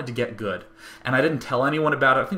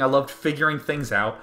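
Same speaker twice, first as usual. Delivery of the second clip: ordinary talk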